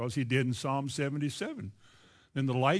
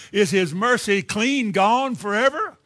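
loud, slow Kentucky drawl, rising at the end; Kentucky version; 4 semitones higher, 8 dB louder, slower than usual. Shown as a demonstration. Preacher showing how we'd say the psalm, in a slow, loud Kentucky drawl. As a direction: draw it out in emphatic Kentucky speech. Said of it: "That's how we'd say it".

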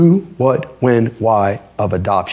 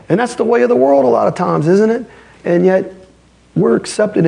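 high, driving sermon voice; raised, urgent pulpit delivery; fiery preaching; 11 semitones higher than usual. Acted. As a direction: preach it hard and fast to the congregation. Preaching at full force.

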